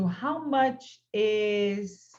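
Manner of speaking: How much is this phone, spaced out slowly for learners; slow model question. Shown as a demonstration. Teacher slowly models the question for her students. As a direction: drag each word out clearly. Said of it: "Quando eu pergunto".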